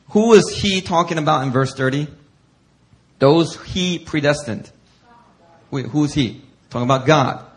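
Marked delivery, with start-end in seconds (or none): none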